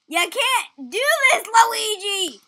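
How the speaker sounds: high, loud scolding plea; scolding Luigi. Real, not acted. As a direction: tell Luigi off. Scolding game character.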